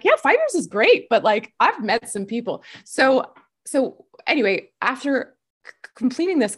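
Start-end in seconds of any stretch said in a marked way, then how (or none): 0.0-1.1 high-pitched, louder quoted reply; retold upbeat reply. Player playing down a long campaign, high playful voice. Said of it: "I'm like".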